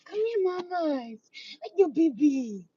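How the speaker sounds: high, drawn-out pet talk; cooing call. Real, not acted. Cat lover beckoning strays closer, high cooing. Calling the cats again.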